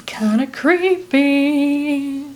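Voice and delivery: slow eerie whisper; very slow hushed creepy whisper; spooky whisper. Real, not acted. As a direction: breathe it out like a ghost tale. Spooky aside after the story.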